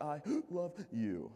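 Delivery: slow, gasping mock sob through I love you; mock sobbing. Retelling a tearful girlfriend at the airport, mock sobbing. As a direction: imitate her crying out the words. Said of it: "in between her sobs".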